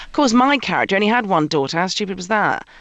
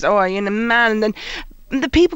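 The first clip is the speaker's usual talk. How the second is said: ordinary talk, nothing marked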